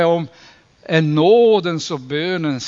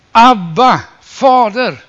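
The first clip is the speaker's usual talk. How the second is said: loud, high cry of Abba, Fader; crying out to God; 6 semitones higher, 8 dB louder, slower than usual. Acted. Believers crying out to their Father, loud high call. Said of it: "vi ropar".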